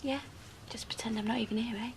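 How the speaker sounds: quick, hushed whisper; whispered aside. Real, not acted. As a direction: whisper it fast, keeping out of the way. Bystander effacing herself during talk.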